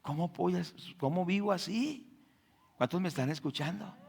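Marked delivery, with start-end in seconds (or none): 0.0-2.1 soft remorseful prayer; quiet self-reproach. Retold or imitated. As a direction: mutter it like a guilty confession. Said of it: "la persona que está en eso debe decir".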